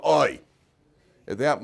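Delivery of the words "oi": a crowd's pained oi; loud groaning oi. Retold or imitated. The people groaning at the spies' report with a loud oi. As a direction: groan together in dismay. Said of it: "the people collectively go".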